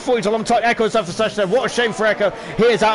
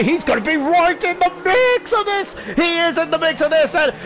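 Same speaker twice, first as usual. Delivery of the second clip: excited; 6 semitones higher than usual. Real, not acted. Commentator thrilled a driver rejoins the fight, excited. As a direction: relish the coming battle.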